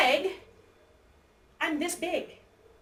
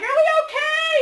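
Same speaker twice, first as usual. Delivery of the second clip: high, louder worried call; acted worry; 11 semitones higher, 5 dB louder than usual. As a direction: call out nervously to a partner. Acting out worry at thunder.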